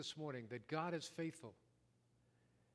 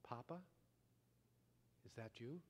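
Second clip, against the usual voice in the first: much softer, a small muffled voice from under rubble; muffled child's voice; 3 semitones lower, 13 dB quieter than usual. A narrator's reading. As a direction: call out faintly, as if buried. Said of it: "a muffled voice that simply said".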